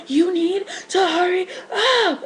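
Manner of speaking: high, slow, tearful yell down the phone; cry-shout it at him, desperate. Retold or imitated. Woman in labor yelling at her boyfriend on the phone, crying.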